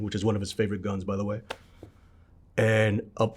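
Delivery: usual speaking voice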